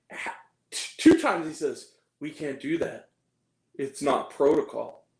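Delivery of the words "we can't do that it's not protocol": mock a wooden actor, low and unemotional; emotionless. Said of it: "That's how he talks. Not with any emotion".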